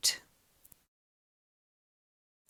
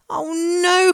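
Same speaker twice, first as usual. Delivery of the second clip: dismayed cry; 10 semitones higher than usual. A narrator's reading. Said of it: "cried George".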